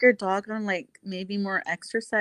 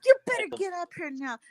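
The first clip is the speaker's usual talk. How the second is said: imitating rowdy challengers; 9 semitones higher than usual. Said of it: "you guys were like".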